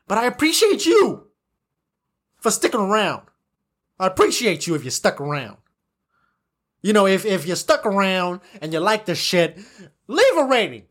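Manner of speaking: usual speaking voice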